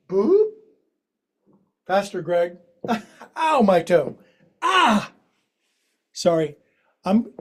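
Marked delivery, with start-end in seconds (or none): 0.1-0.5 playful boop. Acted. Playful sound at end of flow. 3.4-5.1 real yelp of pain, pitch jumping; pained cry. Real, not acted. Hurts toe during fast flow.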